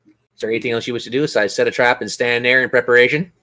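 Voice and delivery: ordinary delivery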